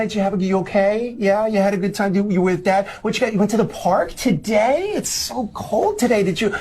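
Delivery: high, gushing, worried fussing; overconcerned parent. Shown as a demonstration. Parent receiving a child back from dad's, high and over-worried. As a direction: fuss over the child as if something bad happened. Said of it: "'cause here's the difference".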